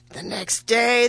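ordinary delivery, nothing marked

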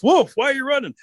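high-pitched urgent holler; calling out. Acted. Rabbit catches up with Fox, calling out. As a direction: Rabbit hollering after a runner. Rabbit calls to running Wolf.

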